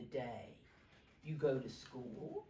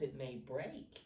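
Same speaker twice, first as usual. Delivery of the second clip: ordinary delivery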